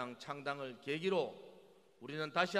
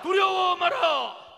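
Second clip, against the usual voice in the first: louder, higher, slow chanted slogan; roaring rallying speech; 17 dB louder, much slower than usual. Acted. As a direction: bellow the slogan for the crowd to echo. Leader calls chant, crowd echoes. (우렁찬 구호 선창)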